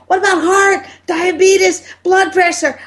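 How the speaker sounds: high shouted question; yelling. Retold or imitated. Doctor yelling his next question at the wife. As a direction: yell it across the room. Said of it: "They're yelling at me".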